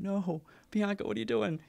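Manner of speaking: high, pleading outcry at the reader; exasperated plea. Retold or imitated. Host reading her memoir, pleading with Bianca in a high exasperated voice. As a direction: cry out at her in disbelief. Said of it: "I kept saying".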